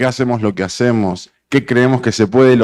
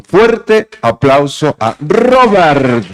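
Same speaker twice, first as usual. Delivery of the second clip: announcing the guest; 5 semitones higher, 5 dB louder, much slower than usual. Real, not acted. Host announces the guest speaker.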